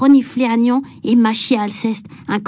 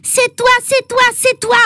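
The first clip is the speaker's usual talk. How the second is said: put-on high, slow tearful child's accusation; a crybaby pupil wailing an accusation at a classmate; Agnan crying out; 11 semitones higher than usual. A narrator's reading. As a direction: wail it like a crybaby pointing the finger. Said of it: "criait Agnan".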